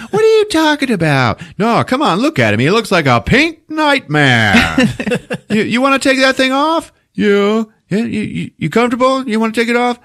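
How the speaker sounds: high, loud insistent mom voice; hammed-up protective mother; mother defending the bunny suit; imitating mom. Mom argues then coaxes Ralphie.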